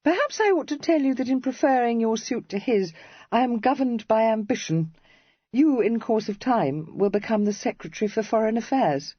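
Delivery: a hint of a spirited young lady teasing; Cressy. A narrator's reading.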